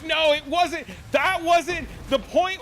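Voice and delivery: teacher caught out, protesting high; flustered teacher. Retold or imitated. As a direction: squeak out a defensive denial. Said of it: "And the teacher was like".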